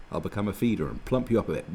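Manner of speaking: usual speaking voice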